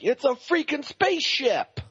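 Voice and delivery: high, drawn-out exasperated exclamation; Wahlberg. Retold or imitated. Host quoting a movie character about the spaceship, high and drawn out. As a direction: imitate a movie star's high-pitched outburst. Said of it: "Wahlberg's like".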